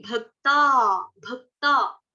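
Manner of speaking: भक्ता said slowly with a long final आ; the right way, भक्ता not भक्त; correct bhakta. Shown as a demonstration. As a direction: hold the आ of भक्ता. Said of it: "भक्त नहीं".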